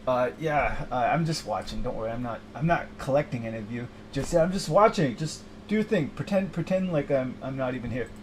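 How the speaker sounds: lightly voiced shy Death. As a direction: mumble awkwardly to the living. Death character, low calm.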